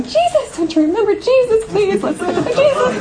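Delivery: high, urgent plea to remember Jesus; pleading cry. Retold or imitated. Retelling pleading with friends during the vote, high and urgent. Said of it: "I was running around going".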